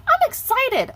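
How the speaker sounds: very high, loud excited squeal; shouted glee, way up high. Real, not acted. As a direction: burst out with over-the-top excitement. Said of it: "I'm so excited".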